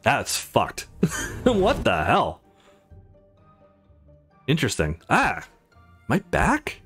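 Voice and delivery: normal talking voice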